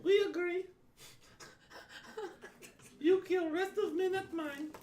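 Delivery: frog man voice. Acted. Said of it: "he'll turn back and go".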